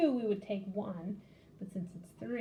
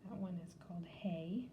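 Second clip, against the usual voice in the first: whisper; 3 semitones lower, 9 dB quieter than usual. Real, not acted. Whispered aside while drawing symbols.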